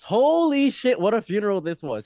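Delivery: high, loud burst of excitement; excited exclamation. Real, not acted. Telling friend about the funeral, excited. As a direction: hype up the story.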